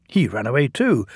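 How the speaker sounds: ordinary delivery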